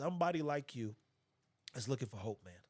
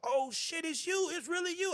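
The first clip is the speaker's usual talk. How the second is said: much higher, louder starstruck squeal; starstruck fan; 5 dB louder than usual. Retold or imitated. Caller recognising him on the line, in a high excited squeal. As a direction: gush like a fan who can't believe it.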